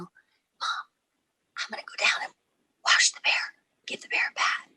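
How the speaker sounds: whispered, so the sleeping mom won't wake; sneaky whisper. Retold or imitated. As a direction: hush it out as a kid slipping away.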